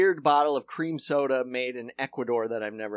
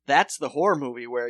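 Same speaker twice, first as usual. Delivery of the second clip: usual speaking voice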